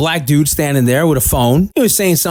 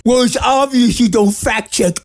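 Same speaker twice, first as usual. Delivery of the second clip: mocking sing-song; 5 semitones higher than usual. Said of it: "some idiot wrote on my page".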